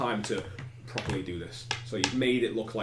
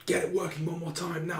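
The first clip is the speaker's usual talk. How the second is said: mild mock shout; 6 semitones higher than usual. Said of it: "he's shouting at people".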